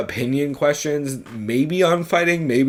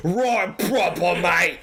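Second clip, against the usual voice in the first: higher, hammed-up Cockney accent; mock Cockney accent, higher pitch; 5 semitones higher, much slower than usual. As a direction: play the slipped-into Cockney, cheeky. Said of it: "I turn into Cockney".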